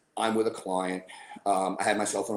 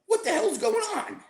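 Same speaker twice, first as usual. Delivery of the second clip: incredulous. Retold or imitated. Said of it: "So I go".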